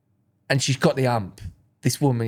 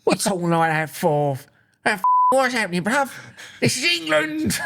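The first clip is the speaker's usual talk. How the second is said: rising, hammed-up outraged bloke ending much higher; mock angry patriot; 4 semitones higher than usual. Mate mocking a friend's reaction, hammed-up outraged high voice. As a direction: play the outraged little Englander for laughs. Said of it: "Be like".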